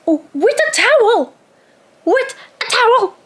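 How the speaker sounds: high, cutting "obviously" tone; sarcastic retort. Retold or imitated. Retorting to the girl at 2 AM, exasperated. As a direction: spell it out like it's obvious.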